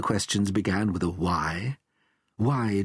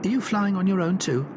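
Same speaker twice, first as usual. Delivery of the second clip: Billy's voice; 9 semitones higher than usual. A narrator's reading.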